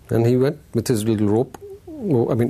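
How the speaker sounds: ordinary talk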